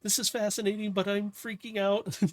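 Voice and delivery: high, anxious character babble; acted high-pitched fretting; nervous mumbling. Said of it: "hear her mumbling away".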